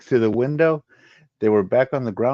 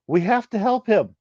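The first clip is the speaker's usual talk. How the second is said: much higher, urgent plea; pleading; 12 semitones higher, 4 dB louder than usual. Acted. The pink Ashara pleads to help Harak, high and urgent. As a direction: beg to go back.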